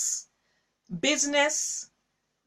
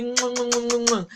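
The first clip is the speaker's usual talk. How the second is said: blown kisses. Real, not acted. Greeting Queen Molly with kisses.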